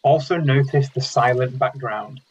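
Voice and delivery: calm meditation guide with long pauses between phrases; unhurried, measured instructions, leaving silence after each; guided meditation. Real, not acted. Meditation leader guiding an online mindfulness group, calm and spaciously paced.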